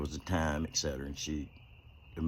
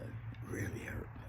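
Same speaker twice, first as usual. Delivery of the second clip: real whispered confession; 9 dB quieter than usual. Real, not acted. Telling viewers how the death hurt, whispered. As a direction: let it fall to a whisper.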